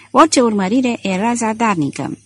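usual speaking voice

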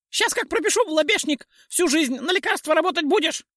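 very high sharp pixie taunt; shrill pixie voice. A narrator's reading. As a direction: jeer up in a tiny piercing voice. Pixie threatens troll.

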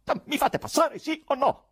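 high, furious shout; terrible yell. A narrator's reading. Poet to nurses blocking his way, yelling. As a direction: yell it high, demanding an answer. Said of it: "urlò il poeta con voce terribile".

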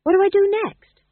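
very high, loud question; frantic plea for direction; urgent appeal. Acted. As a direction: beg for guidance, pitched right up. New king out of his depth.

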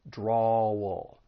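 drawal gliding from DRAW into "ul"; dark L. Shown as a demonstration. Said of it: "move right into that dark L".

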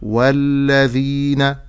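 "والذين" slow, with voiced dh between the teeth; correct dhal. Shown as a demonstration. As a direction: draw out "والذين" with long ee and clear dh. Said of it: "ولكن أقول". ("والذين" بذال صحيحة ومد)

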